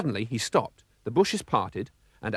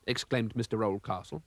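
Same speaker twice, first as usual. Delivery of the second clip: usual speaking voice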